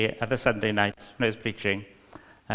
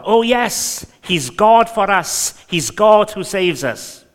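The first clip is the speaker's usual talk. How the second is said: slow, fervent reading, rising higher and louder; preaching read; 4 semitones higher, 7 dB louder, slower than usual. Acted. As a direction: proclaim it building to a joyful peak. Reading the Message translation aloud.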